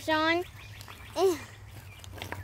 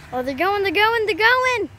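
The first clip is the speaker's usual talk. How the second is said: excited; 6 semitones higher, 7 dB louder than usual. Real, not acted. Excited as the riders set off.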